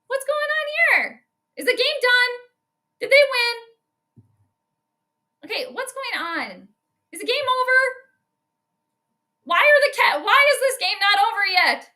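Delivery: high, bewildered questions; confused disbelief. Real, not acted. Fan confused why the game isn't over, high bewildered questions.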